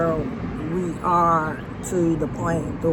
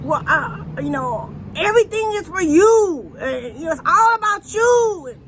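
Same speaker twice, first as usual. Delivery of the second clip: high, loud mock of her frustrated outburst; imitating upset girlfriend; 10 semitones higher, 7 dB louder than usual. Mimicking Janet when he pushes her too far. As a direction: throw her words back, pitched up and exasperated. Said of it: "she'll say".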